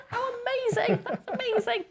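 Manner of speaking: very high, gushing delight; thrilled amazement. Retold or imitated. New joiner finding an appreciation channel already there, thrilled. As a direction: squeal it high with mock-giddy delight.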